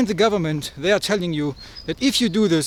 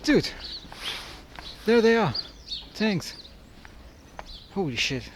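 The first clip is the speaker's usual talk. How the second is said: usual speaking voice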